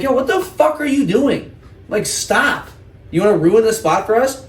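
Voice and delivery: high-pitched, slowed mock yell at the kid; retold confronting yell. Telling off a kid doing burnouts at dawn, high, slow mock yell. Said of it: "I'm like".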